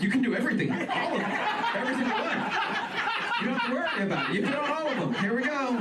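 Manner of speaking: high, bubbly theme park staffer voice; lightly laughing mock cast member; cheery ride attendant. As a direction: gush like an overeager Disney employee.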